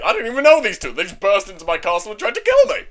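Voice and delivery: high, hammed-up indignation; mock outraged complaining; indignant protest. Demon Lord refuses to help strangers, indignant.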